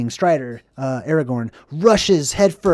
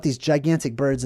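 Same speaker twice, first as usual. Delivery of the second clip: ordinary delivery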